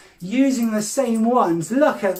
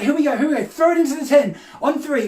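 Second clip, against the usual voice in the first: usual speaking voice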